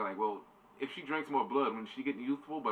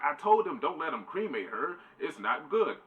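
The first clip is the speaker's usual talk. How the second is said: mock indignation; 7 semitones higher, 5 dB louder than usual. Retelling Dracula's outraged reaction.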